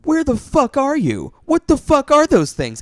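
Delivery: high, loud baffled yelling; confused friend. Retold or imitated. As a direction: squeal it out, totally lost. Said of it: "would come in and watch me and be like".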